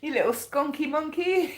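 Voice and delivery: high, giggly teasing; laughing. Real, not acted. Reader teasing the skunk on her card, laughing. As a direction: tease it, about to burst out laughing. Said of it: "I don't know why I'm laughing".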